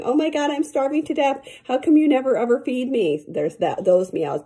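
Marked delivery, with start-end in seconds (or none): 0.0-3.2 high dramatic whine, starving to death; fake meowing. Owner voicing the cat's mock-desperate complaint, high whine. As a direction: overplay the cat's hammed-up complaint. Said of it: "fake meowing".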